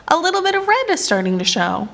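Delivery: high, fast eager voice; a small animal pointing out the dawn; Turtle. Acted. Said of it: "said Turtle".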